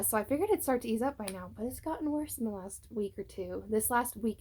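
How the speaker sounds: ordinary talk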